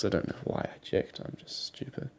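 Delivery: breathy self-mocking whisper; whispered aside. Real, not acted. To viewers, whispering at his own silly check. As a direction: drop to a whisper, scolding yourself.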